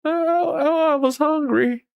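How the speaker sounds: put-on very high, pitiful little-boy voice; retelling a video, the little boy answering his parents, high and pitiful; little boy's voice. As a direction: sound like a caught child pleading. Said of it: "he says that".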